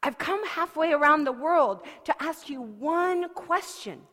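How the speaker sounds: breathless, high and loud; excited. Acted. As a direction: blurt it out, out of breath. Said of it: "out of breath 'cause she was excited".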